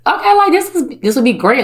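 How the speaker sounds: suddenly thrilled, like it just clicked; a burst of eager delight; excited realization. Retold or imitated. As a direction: pitch jumps way up on okay. Excited realization about working with people.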